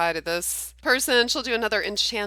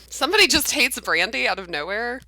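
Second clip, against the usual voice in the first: ordinary talk, nothing marked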